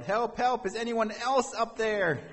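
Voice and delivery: high, loud mock shout; comic desperate yell, high and sustained; yelling for help. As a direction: holler up for another rescuer. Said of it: "finally Jack yelled".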